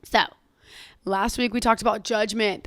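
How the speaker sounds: ordinary talk, nothing marked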